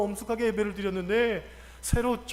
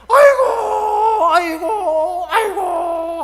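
high, drawn-out loud wail; sudden shouting; 8 semitones higher, 12 dB louder, much slower than usual. Retold or imitated. Imitating worshipper shouting during night prayer, sudden loud wail. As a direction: burst out with long, hammed-up cries. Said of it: "갑자기 소리를 지르는데".

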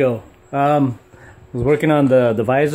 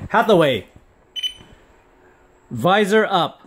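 voice command; 7 semitones higher, 3 dB louder than usual. Real, not acted. Commanding the visor.

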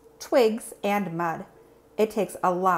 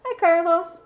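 high, loud bright hello; cheerful greeting; 10 semitones higher, 6 dB louder than usual. Acted. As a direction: welcome him back gladly. Classmates greet rescued Carlos.

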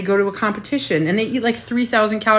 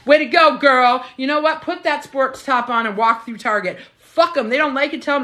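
cheering on; 7 semitones higher than usual. Retold or imitated. Women cheering each other on, mock hyped. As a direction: cheer her on like a best friend. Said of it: "we're supposed to be like".

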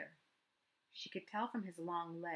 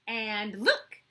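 put-on a child bursting to show more; excited boy; 3 semitones higher, 11 dB louder than usual. A narrator's reading. Matt to Kira, loud excited child.

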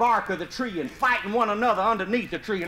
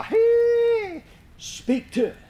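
high ringing holler to the hounds; hollering to the dogs; 4 dB louder, much slower than usual. Retold or imitated. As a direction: call the dogs from across the swamp. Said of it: "old John Eubanks would holler".

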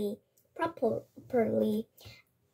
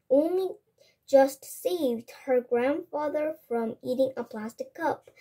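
usual speaking voice

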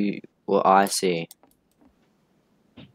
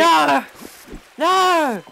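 scared horror game streamer; 5 dB louder than usual. Real, not acted. Dying in the trap.

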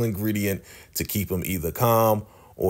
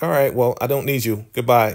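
louder, higher brush-off; dismissive send-off; 6 semitones higher, 4 dB louder than usual. Retold or imitated. Leader sending River away, loud curt dismissal. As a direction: shove him out with a raised voice. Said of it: "He's like".

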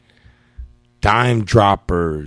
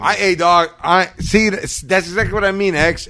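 excited agreement; 7 semitones higher, 5 dB louder than usual. Real, not acted.

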